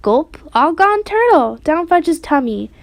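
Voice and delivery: lightly voiced toddler babble; babbled. A narrator's reading. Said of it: "Fudge babbled".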